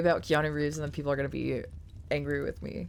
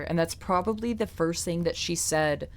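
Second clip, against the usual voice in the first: ordinary talk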